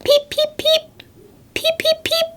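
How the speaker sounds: tiny, very high-pitched chirps; baby bird. Acted. Reading a baby bird's call to children, tiny high peeps. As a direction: peep in a high little chick voice. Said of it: "what we think of a baby bird".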